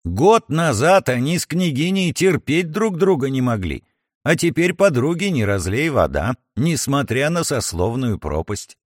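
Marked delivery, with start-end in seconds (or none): none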